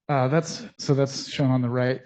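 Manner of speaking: breathless after talking fast; out of breath. Real, not acted. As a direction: speak while catching breath. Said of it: "I have to catch my breath".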